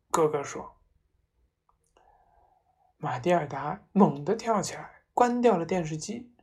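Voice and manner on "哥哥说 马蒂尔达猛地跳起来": ordinary delivery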